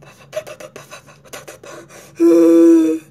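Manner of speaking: big heavy groaning sigh; loud exasperated sigh. Retold or imitated. Showing her sad mood with a heavy sigh. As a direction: heave a loud fed-up sigh. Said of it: "the next second I'm like".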